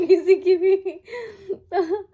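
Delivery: laughing out the words high and loud. Real, not acted. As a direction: laugh through it, bursting. Laughing about father hitting anyone.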